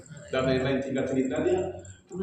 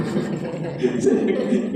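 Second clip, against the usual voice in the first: short breathy chuckle; real laugh; 4 semitones higher than usual. Real, not acted. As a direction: chuckle at the joke. Laughs at own joke.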